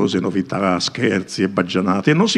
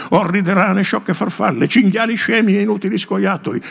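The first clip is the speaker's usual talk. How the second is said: high, rowdy mocking cries; a rowdy gang shouting out jeers; jeering shout; 10 semitones higher than usual. A narrator's reading. Rowdy companions shouting insults.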